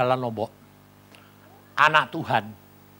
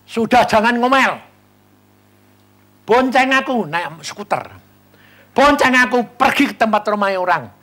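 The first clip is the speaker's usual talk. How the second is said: bossy order; 7 semitones higher, 8 dB louder than usual. Retold or imitated. Bossing friend, stop grumbling.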